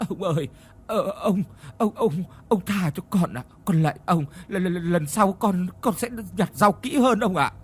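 high, halting, terrified plea; begging for mercy. Acted. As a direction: stammer out a plea for mercy from the master. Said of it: "người van xin dưới chân của lão".

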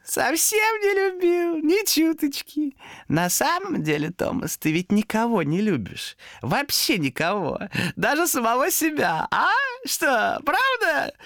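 high, slow, drawn-out creature voice; needling demon. Acted. Demon says Томас loves nobody, needling. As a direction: taunt him, stretching the words gleefully.

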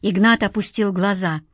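plain reading voice

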